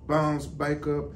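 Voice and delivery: usual speaking voice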